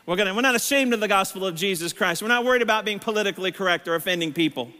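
normal talking voice

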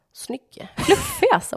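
whispered snygg, then Fluffig way up high; mock admiring. Shopper admiring the ugly hat, whisper then high squeal. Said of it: "Jag bara".

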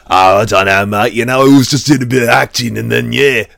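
a light impression of his chatty British interview voice; Bale's own British accent. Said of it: "when you see him in interviews going".

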